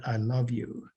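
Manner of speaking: soft, quiet, emotion rising; choked up. Real, not acted. Retelling the widow's story, quietly choked up. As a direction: let the feeling catch in the words. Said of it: "I get emotional saying that".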